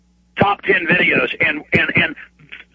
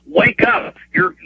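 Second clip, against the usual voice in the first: urgent call to police; 11 semitones higher, slower than usual. Real, not acted.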